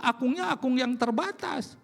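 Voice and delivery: ordinary talk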